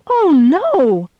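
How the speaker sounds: high, loud "Oh, no!"; hammed-up loud dismay; cry of dismay. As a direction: wail it out in mock alarm. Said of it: "I cried".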